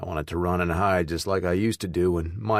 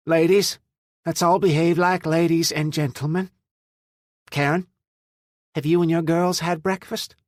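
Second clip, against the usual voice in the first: high, loud firm but kind; smooth over the quarrel; peacemaking; 10 semitones higher, 5 dB louder than usual. A narrator's reading. Mother calming a fight.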